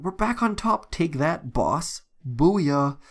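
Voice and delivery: high, gloating victory call; pumped-up celebration, punching the last word; triumphant cheer. Real, not acted. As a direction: crow over beating the boss. Celebrating a win over the boss.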